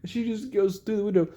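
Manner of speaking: usual speaking voice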